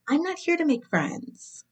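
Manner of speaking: high, haughty reality TV catchphrase; reality show diva. Retold or imitated. Mocking a reality show contestant, haughty diva voice. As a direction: deliver it like a Top Model contestant. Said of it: "Do I sound like those girls on".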